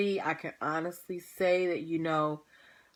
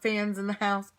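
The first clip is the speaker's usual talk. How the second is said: usual speaking voice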